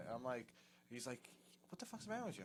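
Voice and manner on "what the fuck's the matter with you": high, soft-volume exasperated telling-off; retold hushed scolding. Sal telling off his drunk friend, hushed and exasperated. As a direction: scold him while keeping the volume down.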